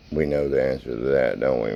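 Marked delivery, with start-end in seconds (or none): none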